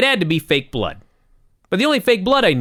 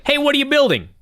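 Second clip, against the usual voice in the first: imitating Lawler; 10 semitones higher than usual. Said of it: "Lawler goes over there with a mic and he's like".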